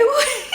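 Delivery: short high-pitched giggle; a quick squeaky laugh. Real, not acted. Laughing at herself while telling viewers. Laughing at her own emotion.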